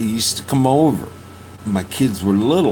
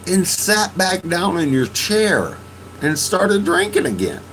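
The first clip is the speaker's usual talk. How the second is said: pleading, raised voice; 10 semitones higher than usual. Retold or imitated. To drunk friend, a high pained plea. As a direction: beg him to see himself. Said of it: "I'm like".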